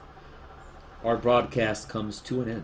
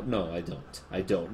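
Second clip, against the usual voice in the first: ordinary talk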